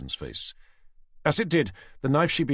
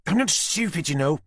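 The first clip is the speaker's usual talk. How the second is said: high, louder indignant snap; Susan snapping; 8 semitones higher, 4 dB louder than usual. A narrator's reading. Susan snapping at the detective. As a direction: bristle at the insult.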